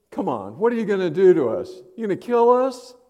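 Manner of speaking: high, loud, mocking dare; sarcastic taunt. Demon mocking Jesus with sarcastic, loud taunt. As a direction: taunt him, daring him to act. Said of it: "I wonder if this is sarcasm".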